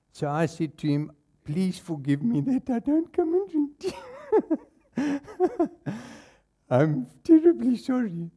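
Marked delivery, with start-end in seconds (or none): 1.4-5.9 high, giggly fake contrition; laughing mock apology. Mock apology to complaining member. 6.7-8.3 "terribly" high and giggly, mocking; laughing fake apology. Mock apology.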